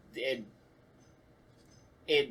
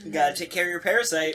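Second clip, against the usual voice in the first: teasing; 5 semitones higher, 6 dB louder, much faster than usual. Pretend. Teasing joke about parasite.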